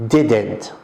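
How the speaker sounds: "Didn't" said alone, low and falling; stressed word. Shown as a demonstration. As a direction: isolate "Didn't" for the class. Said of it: "That's why I used didn't".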